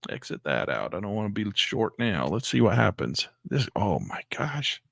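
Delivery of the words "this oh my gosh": slow stunned whisper. Real, not acted. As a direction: breathe it out in shock. Watching the chart surge.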